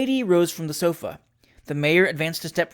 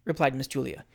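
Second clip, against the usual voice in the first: ordinary delivery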